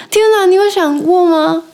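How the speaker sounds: high, shocked inner cry; exasperated disbelief. Retold or imitated. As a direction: blurt it out in disbelief. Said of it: "然后我想说".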